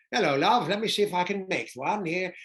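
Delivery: high, loud imitated night manager. Hotel night manager offering guest coffee, high loud character voice. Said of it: "And he goes".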